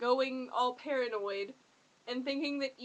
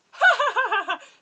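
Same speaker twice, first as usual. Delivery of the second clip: loud high psycho cackle, imitating Eli; manic laugh; 10 semitones higher, 13 dB louder than usual. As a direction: cackle like a crazed villain. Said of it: "I like his crazy manic laughs".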